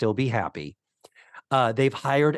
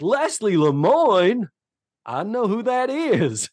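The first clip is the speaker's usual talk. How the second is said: high, drawn-out, wide-eyed excitement; Vinton; 8 semitones higher, 3 dB louder than usual. Retold or imitated. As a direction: chipper innocent, thrilled to recognize a name. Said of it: "he's like".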